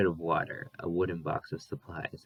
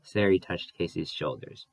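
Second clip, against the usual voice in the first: plain reading voice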